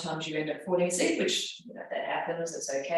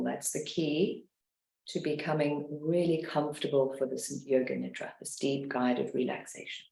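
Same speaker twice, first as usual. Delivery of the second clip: guided meditation; 2 semitones lower than usual. Acted. Leads a guided relaxation.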